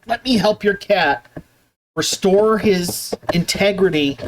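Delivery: normal talking voice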